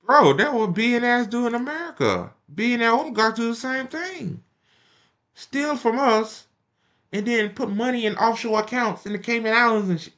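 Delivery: high-pitched exasperated rant; heated rant. Real, not acted. Vlogger ranting back at Bernie on billionaires, heated exasperation. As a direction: pitch up, pile on the outrage.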